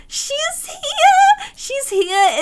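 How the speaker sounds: high, thrilled squeal; breathless delight; excited squeal. Real, not acted. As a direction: gush at her arrival. Excited about new sim.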